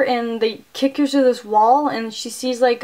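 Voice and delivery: ordinary talk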